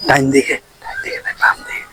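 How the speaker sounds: barely audible, voiceless whispered directions; hushed to almost nothing; exaggerated whisper. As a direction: whisper it so softly the listener misses it. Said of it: "একটু আস্তে আস্তে কর না".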